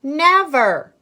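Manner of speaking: loud high defiant shout; loud refusal. Acted. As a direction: plant your feet and shout it. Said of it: "cried Annalisa".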